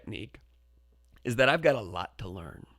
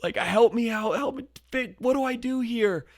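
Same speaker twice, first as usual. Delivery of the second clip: pleading; 9 semitones higher, 3 dB louder than usual. Retold or imitated. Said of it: "silently speak to her".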